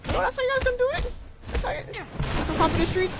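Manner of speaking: fast, high indignant squeal; outraged disbelief. Real, not acted. Outraged at being shot.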